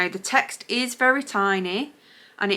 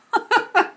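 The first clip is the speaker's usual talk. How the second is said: short giggle that falls away; high amused giggle; 14 semitones higher than usual. Real, not acted. Vlogger laughing at her Scottish word mix-up, high giggle. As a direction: laugh at your own mistake.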